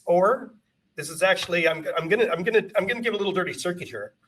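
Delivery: usual speaking voice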